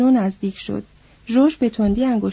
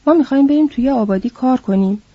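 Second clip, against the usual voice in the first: ordinary delivery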